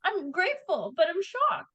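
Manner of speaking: high, stunned voice; real shock. Real, not acted. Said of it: "I'm so shocked".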